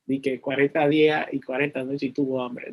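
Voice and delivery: low, soft wry aside; wry mock disbelief. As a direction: repeat it as if you can't quite believe it. Jokes in disbelief at the verse.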